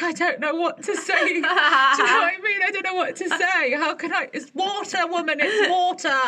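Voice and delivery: high-pitched, laughing mock despair; comic exasperation. Diner at a loss with the waitress, exasperated.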